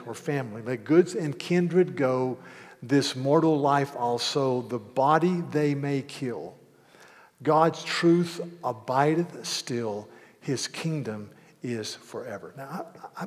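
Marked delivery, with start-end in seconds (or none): 0.6-12.5 each hymn word drawn out slowly; slow solemn hymn recitation. Shown as a demonstration. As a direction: recite "let goods and kindred go" slowly and solemnly. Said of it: "he says, in part, this".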